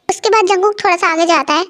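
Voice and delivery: ordinary delivery, nothing marked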